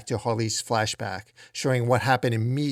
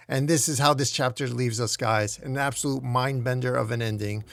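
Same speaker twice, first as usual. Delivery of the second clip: plain reading voice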